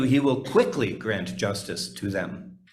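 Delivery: ordinary delivery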